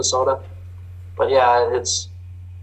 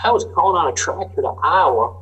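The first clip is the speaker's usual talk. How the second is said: higher southern farmer drawl; 6 semitones higher than usual. Retold or imitated. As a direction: tell it like a southern farmer complaining. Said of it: "I apologize for my southern drawl and impersonation".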